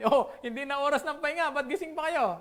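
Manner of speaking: high, laughing mock boss scolding. Preacher voicing a boss waking idle workers, laughing. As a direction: play the boss catching sleepers, half laughing. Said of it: "naunang gumising ang boss".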